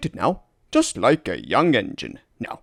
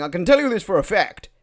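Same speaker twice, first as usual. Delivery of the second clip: ordinary talk, nothing marked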